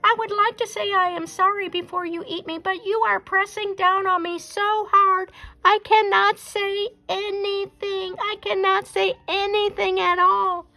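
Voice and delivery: very high, squeaky pleading voice; pleading squirrel. Acted. Pinned Squirrel sweet-talks Bear, very high squeaky pleading. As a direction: beg sweetly like a trapped squirrel.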